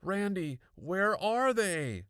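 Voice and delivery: high, loud, stretched-out demand; frantic. A narrator's reading. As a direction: frantically press for an answer. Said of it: "I repeated frantically".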